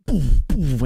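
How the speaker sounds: punchy mock "boom, boom", pitch dropping on each; imitated shifter noise. Streamer showing viewers how a truck-rig shifter works, punchy imitated booms. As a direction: make the sound of slamming gears.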